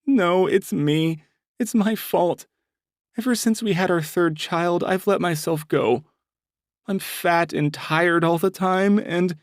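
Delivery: acted tearful confession; crying. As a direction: break down as you confess it. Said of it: "when a woman cries".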